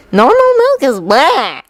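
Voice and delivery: high, drawn-out distaste; disgusted. A narrator's reading. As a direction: end on a disgusted noise. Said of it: "making a disgusted noise at the end".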